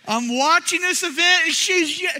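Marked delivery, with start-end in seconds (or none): none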